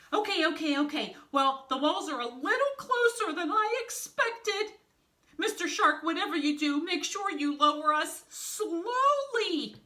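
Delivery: high, loud, strained panic; panicked. Acted. Wolf panics dangling near walls.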